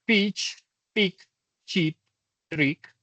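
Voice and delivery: teacher modelling the short I, a bit exaggerated; short I demo. Shown as a demonstration. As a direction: snap the I short in "pitch" and "trick". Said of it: "La corta I".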